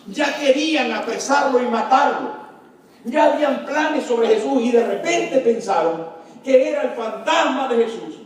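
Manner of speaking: usual speaking voice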